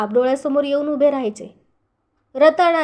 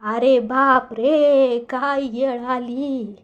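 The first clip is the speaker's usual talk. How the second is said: put-on very slow, fading old man's cry of dismay; old grandfather lamenting a terrible moment; shocked old man; much slower than usual. A narrator's reading. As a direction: drag it out like a stricken grandfather. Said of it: "बुडा जोरानं ओरडला".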